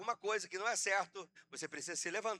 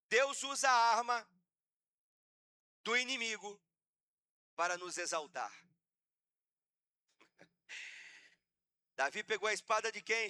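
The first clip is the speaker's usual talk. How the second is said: normal talking voice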